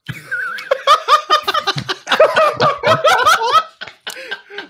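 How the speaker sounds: long high peal of laughter. Real, not acted. Recording froze, group laughs.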